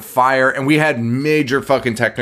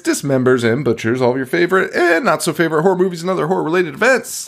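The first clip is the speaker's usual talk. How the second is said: show host intro; 6 semitones higher, faster than usual. Acted. Host welcoming listeners to the show, announcer style. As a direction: greet the audience like a showman.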